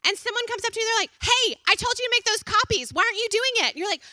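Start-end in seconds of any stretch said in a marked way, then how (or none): 1.2-3.7 annoyed scolding. Retold or imitated. Coworker scolds new employee.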